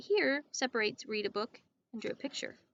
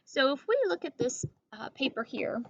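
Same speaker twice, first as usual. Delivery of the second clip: normal talking voice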